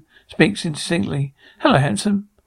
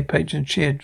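normal talking voice

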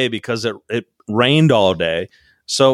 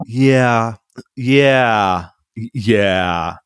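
drawn-out, spaced-out mock yeahs; imitating repeated yeahs; 3 semitones lower, much slower than usual. Mocking the contestant's small talk, drawn-out imitation. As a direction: mimic her with long, separate yeahs. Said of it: "Gabby is the woman of".

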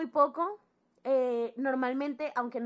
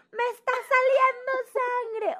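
higher, louder, slow crying wail; hammed-up sobbing wail; 13 semitones higher, 7 dB louder, slower than usual. Girl panicking to her best friend, mock crying wail. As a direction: wail it like a child who thinks she's dying. Showing friend the bloody paper.

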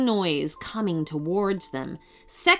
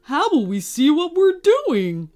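dim Connor voice; 6 semitones higher, 5 dB louder than usual. A narrator's reading. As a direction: play the dopey brother. Connor character voice.

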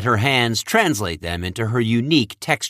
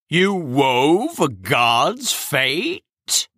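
slow, stunned disbelief; mortified; 4 semitones higher, much slower than usual. Acted. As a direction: drag it out in horror. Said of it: "in a mortified tone".

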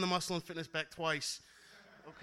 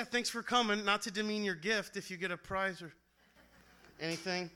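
ordinary talk

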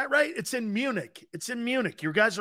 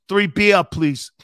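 put-on loud, accented ordering voice; hammed-up counter order; imitated beer customer; 3 semitones higher, 9 dB louder than usual. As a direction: call out the order like a foreign customer. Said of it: "This guy goes".

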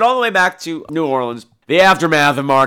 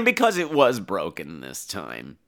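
hushed aside; 8 semitones lower, 6 dB quieter, slower than usual. Real, not acted. Hushed aside after the mock shout.

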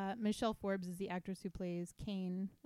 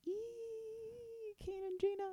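high, drawn-out squeal of delight; fangirl squeal; 3 dB quieter, much slower than usual. Acted. Podcaster reading out her note on a new couple, excited squeal.

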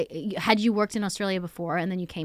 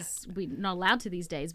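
normal talking voice

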